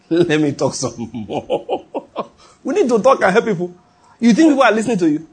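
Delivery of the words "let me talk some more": slow, drawn out with a laugh. Real, not acted. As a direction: chuckle and stretch the words out. Amused repeat of his own line.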